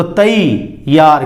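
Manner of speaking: तैयार drawn out slowly, ai clearly sounded; slow careful pronunciation. Shown as a demonstration. Teacher slowly models correct sound to students, तैयार. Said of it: "अय न होकर ऐ हो जाएगी".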